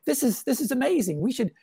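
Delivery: high, wondering animal voice; amazed wildlife. Acted. Cartoonist voicing wildlife during the lockdowns, amazed and high. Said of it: "wildlife sort of was saying".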